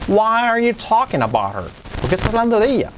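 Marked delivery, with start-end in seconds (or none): 0.1-1.7 "why are you talking about her" pitched high throughout, falling on "her"; expressive intonation. Shown as a demonstration. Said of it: "Y con entonación". ("why are you talking about her" con entonación marcada)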